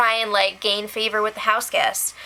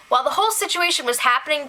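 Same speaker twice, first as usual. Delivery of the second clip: ordinary talk, nothing marked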